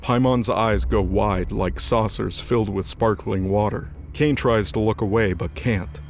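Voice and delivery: usual speaking voice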